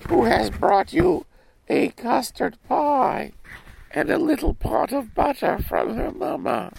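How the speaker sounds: put-on a small girl reciting sweetly; little girl voice. Girl's line at the door.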